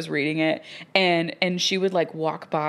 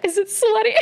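much higher mock older lady; high mock question; 4 dB louder than usual. Flight attendant asking a reader, high mock voice. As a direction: squeak it out in disbelief. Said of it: "And she's like".

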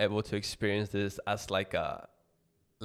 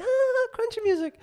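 very high, louder carefree impression; squeaky blissed-out voice of a happy friend; imitating happy friend; 9 dB louder than usual. Teasing his always-happy cohost, imitating him in a squeal. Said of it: "You're always happy, like".

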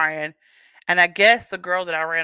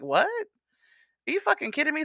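high, disbelieving retort; staring at her in shock; incredulous; 8 semitones higher, 3 dB quieter than usual. Retold or imitated. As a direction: victim reacting to friend's plea to cover for him. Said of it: "looking at her like".

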